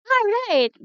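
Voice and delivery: high, loud "all right"; big cheery encouragement; cheer. Real, not acted. Cheering child on.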